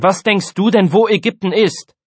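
high, loud challenge; exasperated bickering. A narrator's reading. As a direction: demand it of a dim classmate. Exasperated pupil in the squabble.

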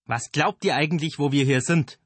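high, loud angry yell; shouting. A narrator's reading. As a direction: yell at them while running. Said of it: "schrie der spindeldürre Mann".

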